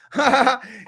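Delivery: a big mock guffaw, mouth wide open; acted hearty laugh. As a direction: laugh loudly, as if at a great joke. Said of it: "Will Smith's like".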